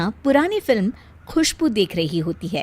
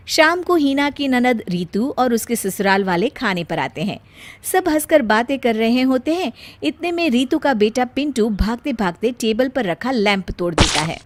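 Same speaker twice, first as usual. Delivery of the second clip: ordinary delivery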